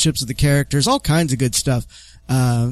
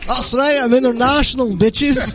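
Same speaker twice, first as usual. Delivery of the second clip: put-on Australian accent; 7 semitones higher than usual. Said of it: "Where the fuck are you from".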